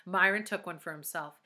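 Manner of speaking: usual speaking voice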